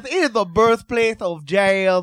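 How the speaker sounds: slow, weighty pronouncement; defending the city with grand slow emphasis; slow emphatic announcer. Pretend. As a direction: lean on every word like a tour guide.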